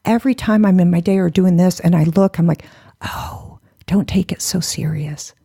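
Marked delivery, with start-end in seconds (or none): 3.0-5.3 whispered sigh into soft speech; hushed, letting-go exhale; gentle self-reminder. Retold or imitated. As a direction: soothe yourself softly. Retold soothing words to herself.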